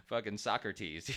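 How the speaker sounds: a lightly hammed-up highbrow voice, pitched a little up; mock pompous philosopher. Podcaster mocking himself as a lofty philosopher, lightly hammed-up. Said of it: "It's not like".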